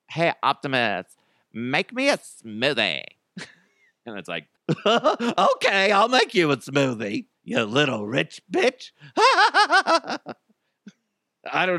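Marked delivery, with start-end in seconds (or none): 0.1-3.0 put-on voice of an entitled owner; rich owner. Rich owner orders the robot butler, affected voice. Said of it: "the rich people are gonna soon have one". 4.8-8.9 sneering, gleeful clown voice; evil clown. Acted. Robot answers its rich owner, evil clown voice. 9.2-10.3 very high maniacal cackle; hammed-up villain laugh; evil clown cackle. Robot mocks its rich owner, evil clown cackle.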